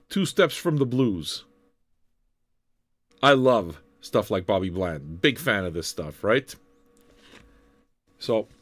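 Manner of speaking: ordinary delivery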